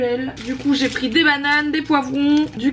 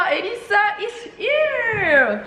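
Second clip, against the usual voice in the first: mock English accent; 9 semitones higher, much slower than usual. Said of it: "quel accent anglais?".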